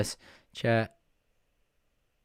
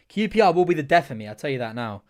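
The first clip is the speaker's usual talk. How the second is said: ordinary delivery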